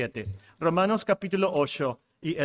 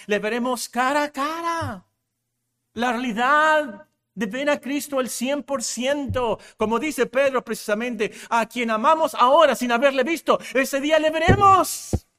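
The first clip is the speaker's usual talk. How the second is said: higher, building to a loud climax; rousing preaching; 6 semitones higher, 4 dB louder than usual. Real, not acted. Preacher tells believers they will see Christ, rousing preaching. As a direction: preach it up to a ringing final cry.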